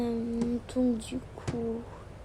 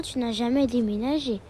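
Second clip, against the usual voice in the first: ordinary talk, nothing marked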